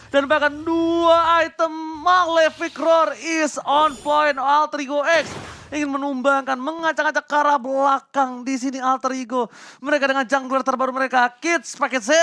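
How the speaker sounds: high, loud hype call; esports caster. Acted. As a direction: shout the big play out. Caster reacts to big teamfight.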